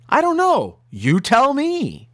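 mock quote, pitched high, drawn out at the end; high-pitched mocking report of someone's supposed words; retold divine reply. Preacher retelling the Lord's supposed answer, high mock quote. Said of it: "the Lord said to him in a verbal voice".

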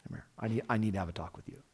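quiet, confidential pull-aside; hushed aside. Retold or imitated. Peter pulling Jesus aside, hushed and urgent. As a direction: murmur it like a friend taking him aside. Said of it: "Then Peter pulls him aside".

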